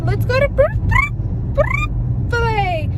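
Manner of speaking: put-on high, halting toddler voice stuck on Br-; toddler stumbling on the word. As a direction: imitate a little kid failing to say Chick-fil-A. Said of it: "And he would like".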